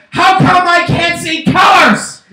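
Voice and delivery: high, slow anguished shout; dog's outburst. Acted. Dog joining the couple's argument, high mock-anguished shout. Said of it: "And then the dog says".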